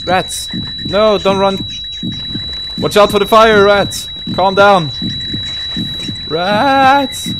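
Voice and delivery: loud, high panicked shouts at the rats; frantic real yelling. Real, not acted. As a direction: scream warnings as they swarm. Yelling at rats chasing her.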